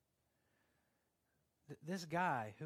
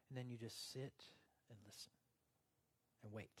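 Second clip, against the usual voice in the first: sinks to near silence on listen; fading hush; 4 semitones lower, 10 dB quieter, faster than usual. Real, not acted. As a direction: trail off into quiet.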